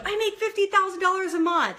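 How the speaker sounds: high, loud, gung-ho declaration; mock affirmation. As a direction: proclaim it like an over-keen affirmation. Mimics someone declaring bold affirmations.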